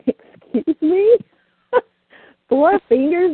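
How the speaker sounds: high, loud incredulous "excuse me"; mock-scandalized, drawn-out retort; shocked disbelief. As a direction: feign outrage, slow and high.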